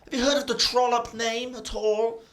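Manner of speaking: high, loud, indignant lady; haughty captive woman. Acted. GM voices the captive woman demanding release. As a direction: demand it like an offended noble. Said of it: "the woman as well is saying".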